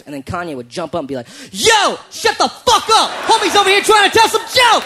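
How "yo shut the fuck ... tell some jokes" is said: very high, loud yell at the kitchen. Retold or imitated. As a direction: bellow at them to shut up. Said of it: "best thing anyone's ever yelled".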